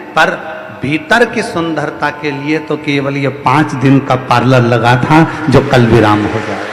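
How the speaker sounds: rising, rhythmic sermon delivery; preaching. Acted. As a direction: preach it to the crowd with swelling repetition. Guru preaching to devotees. (प्रवचन की लय में उपदेश)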